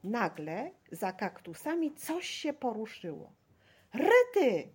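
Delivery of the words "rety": a high, loud startled cry; shouting. A narrator's reading. Boy spots a girl behind cactuses, shouting. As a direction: yell it out in sudden alarm. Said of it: "krzyknął Staszek".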